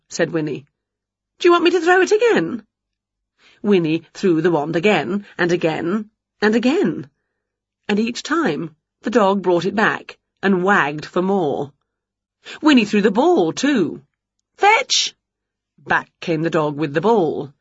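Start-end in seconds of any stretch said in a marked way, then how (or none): 1.4-2.6 high, quick question; excited dog talk. Acted. Winnie talks to an eager dog. 14.6-15.2 high-pitched call to a dog; excited command. Acted.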